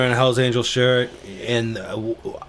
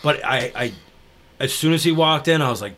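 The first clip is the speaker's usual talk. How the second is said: normal talking voice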